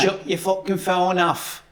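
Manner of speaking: put-on Northern accent. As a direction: bark it like a Stockport local. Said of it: "We'll do that in Northern accent".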